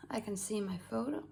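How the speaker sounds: ordinary talk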